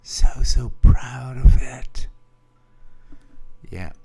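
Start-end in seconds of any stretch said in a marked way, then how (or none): 0.0-2.1 whispering. Acted. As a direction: whisper it proudly into the mic. Leaning into new microphone.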